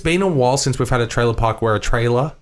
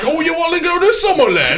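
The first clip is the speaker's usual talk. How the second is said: high, hammed-up British villager; eager villager. Villager egging on a mate about going to Summerland, eager high voice. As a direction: pipe up as a cheeky local yokel. Crowd voice in comic bit.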